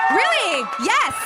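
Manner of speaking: loud, high shout of delight; excited shout. Real, not acted. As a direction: cheer back at the Miami people. Greeting Miami fans in crowd.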